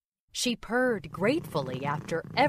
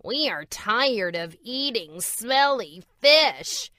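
higher and louder, a complaining grumble; grumbling; 5 semitones higher, 4 dB louder than usual. A narrator's reading. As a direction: gripe about the fish like a spoiled cat. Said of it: "they grumbled".

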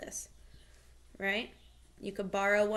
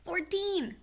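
whisper yelling; 8 semitones higher than usual. Shown as a demonstration. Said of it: "whisper, shout your answer".

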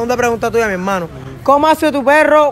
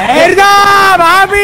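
excited shout; 7 semitones higher, 6 dB louder, much slower than usual. Real, not acted. Host cheers correct quiz answer.

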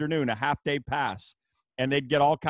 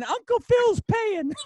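put-on much higher, slow gruff old-coach voice; Joe Paterno impression; 4 dB louder, slower than usual. Old coach offering a free bourbon, high slow gruff voice. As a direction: drag out the line like a cranky old coach. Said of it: "He goes".

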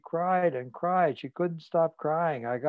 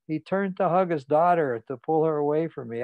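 usual speaking voice

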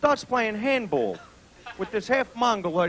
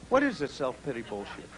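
ordinary talk

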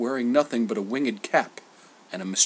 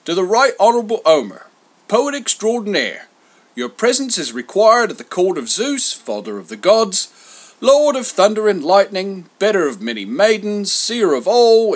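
high, loud herald's reading; lusty proclamation; 10 semitones higher, 9 dB louder than usual. A narrator's reading. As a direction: declaim it grandly to the whole crowd. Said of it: "in a lusty voice began".

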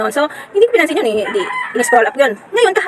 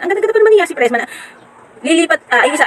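usual speaking voice

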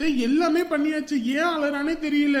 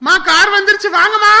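loud, high, hurried call; urgent calling out; 7 semitones higher, 11 dB louder than usual. Acted. Son calling family to the car, urgent shout.

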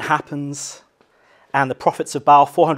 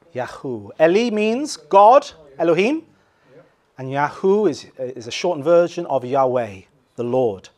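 ordinary delivery

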